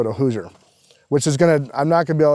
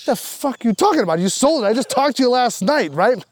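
higher-pitched, indignant retold outburst; pitched-up mock fury, not shouted; retold anger; 10 semitones higher than usual. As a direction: replay the outrage at the seller. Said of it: "So I'm fucking pissed".

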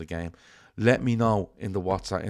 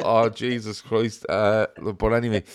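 ordinary talk

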